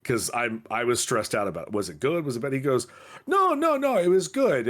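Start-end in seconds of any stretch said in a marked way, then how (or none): 3.3-4.7 high-pitched acted "no, no, no"; quick to soothe, voice pitched up; hasty reassurance. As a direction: jump in high to reassure, imitating someone. Acted reassurance after anxious question.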